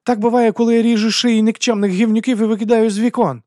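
high, loud, furious boast; barked taunt. A narrator's reading. Woman boasts of killings to the duke, barking. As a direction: bark it at the top of the register. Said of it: "гаркнула вона".

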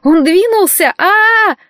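high terrified scream; screaming that the mannequin moved. A narrator's reading. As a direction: shriek it out in panic. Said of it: "и заорала".

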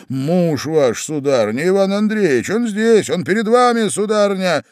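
rising to a high, loud pitch; agitated announcement. Acted. Husband confronts his lady outside the house, increasingly agitated. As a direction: build in agitation toward the reveal.

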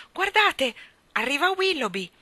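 high, loud warning cry; sudden exclamation. A narrator's reading. Michael warns the others of the dog, exclaiming. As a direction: burst out with it. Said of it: "sbottò in un'esclamazione".

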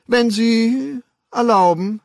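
high, drawn-out polite request; timidly polite. A narrator's reading. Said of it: "Mit der zaghaften Freundlichkeit dessen". (zaghaft höflich)

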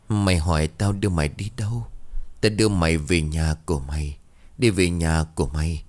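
usual speaking voice

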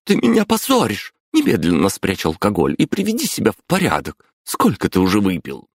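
high scolding mother. Acted. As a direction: tell him off, outraged. Мама отчитывает пьяного отца. (возмущённо отчитывая)

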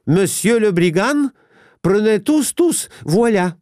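high-pitched, frantic French; frightened French plea. A narrator's reading. Wife offers the money to the supposed robbers, frightened French plea. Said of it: "обратилась к чиновнику по-французски".

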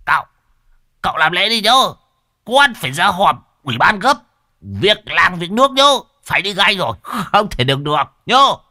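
high, loud panicked shout; the medium bolting out, yelling excuses; frantic yell. Acted. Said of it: "Lão gào lên". (lão đồng gào lên hoảng hốt, giọng cao to)

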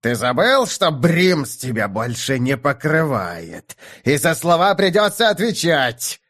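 slow, then bursting into a shout; threaten, building to a yell; furious. A narrator's reading. Keren on the edge of exploding.

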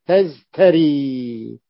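تزتري drawn out very slowly, sounding each letter; تزتري said syllable by syllable, very slow; original form spelled out. Shown as a demonstration. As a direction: stretch تزتري slowly with a clear ت. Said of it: "أصله تزت".

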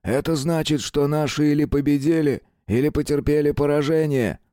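a gruff soldier, frowning; a hint of a grim sergeant. A narrator's reading. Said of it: "нахмурившись". (хмурый сержант)

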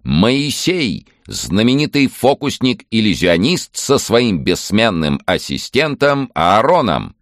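high, slow showman's announcement; booming prize announcer. Acted. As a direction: boom it out like a circus ringmaster. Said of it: "Ведущий объявил".

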